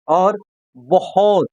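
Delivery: बहुत said slowly, the h opened out; slow and drawn out. Shown as a demonstration. Teacher slowly spelling out a word for students. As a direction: stretch बहुत so every sound is heard. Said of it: "हा पर जोर दिया है".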